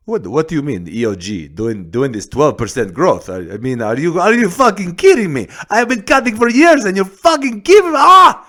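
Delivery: high, loud Russian boxer rant; angry Russian accent. Acted. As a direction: rage like an angry movie Russian, Russian accent building to a yell. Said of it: "some sort of angry boxing Russian".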